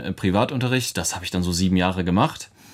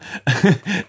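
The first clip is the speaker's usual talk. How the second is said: laugh; 10 semitones higher, 3 dB louder than usual. Real, not acted. Laughs at his own joke.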